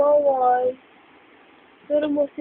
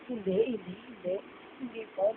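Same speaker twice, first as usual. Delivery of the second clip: soft soothing; 5 semitones lower, 15 dB quieter, faster than usual. Real, not acted. Soothing baby softly.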